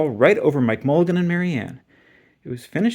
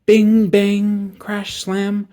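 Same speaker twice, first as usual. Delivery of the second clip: noisy digging sounds; 6 semitones higher, 6 dB louder, much slower than usual. A narrator's reading. Shovel noises, read dramatically.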